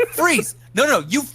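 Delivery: high, loud cop shout. Acted. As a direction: yell freeze at the runaway. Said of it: "she's like".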